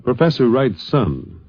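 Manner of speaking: ordinary talk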